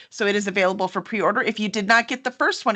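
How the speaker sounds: normal talking voice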